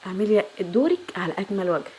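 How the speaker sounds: ordinary delivery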